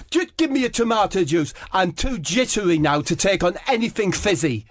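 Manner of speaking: high, prim Harry Kane voice; Harry Kane ordering a tomato juice, high and fretful; Harry Kane impression. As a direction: fret it out like a fussy footballer. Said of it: "So Harry says".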